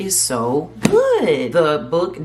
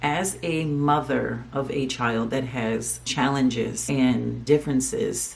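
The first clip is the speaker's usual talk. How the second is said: ordinary talk, nothing marked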